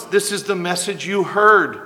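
ordinary delivery

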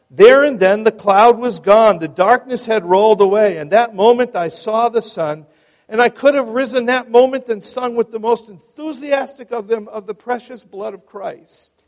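high, loud triumphant testimony; ringing oratory, softening at the very end; exultant account reading. A narrator's reading. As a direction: declaim the moment of conversion to a congregation. Reads climax of conversion account.

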